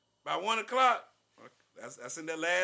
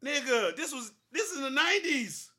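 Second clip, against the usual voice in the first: high, loud, laughing disbelief; incredulous; 9 semitones higher, 6 dB louder than usual. Real, not acted. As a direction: rant like you can't believe it. Incredulous rant about bill.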